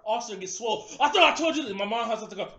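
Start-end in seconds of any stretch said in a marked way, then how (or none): 1.0-1.7 higher, louder threatening snap; angry cop. Retold or imitated. Officer confronting the son again, loud and angry. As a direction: come at him like an angry cop.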